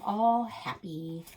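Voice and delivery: ordinary delivery, nothing marked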